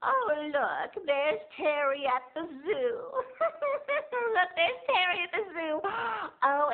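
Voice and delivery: high, slow, fussy family voice; old relatives voice. Retold or imitated. Relatives flipping through snapshots on the couch, high puzzled voice. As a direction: drawl like puzzled old folks leafing through photos. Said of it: "they were like".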